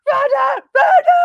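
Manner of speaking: very high, loud crowd yells; screaming fans. Retold or imitated. Fans screaming her name the whole way in. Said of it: "no one was screaming shit".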